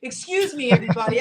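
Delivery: loud call to the whole line. Retold or imitated. Woman calling to the airport queue, loud. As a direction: grab everyone's attention. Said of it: "I said".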